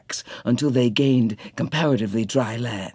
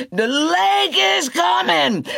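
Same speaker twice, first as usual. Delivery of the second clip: crying out. A narrator's reading. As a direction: shout it to everyone fleeing. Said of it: "crying the same as they went".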